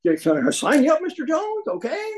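high, chirpy sales-pitch voice; mock telemarketer. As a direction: sell it like a phone solicitor closing the deal.

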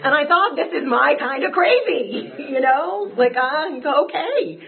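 real rush of feeling at the memory; overcome with emotion. Real, not acted. Speaker reliving relief at finding his people, overcome. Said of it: "emotional memory of first meeting".

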